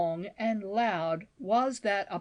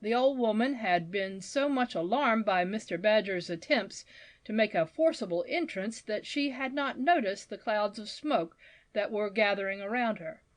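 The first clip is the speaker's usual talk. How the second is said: ordinary delivery, nothing marked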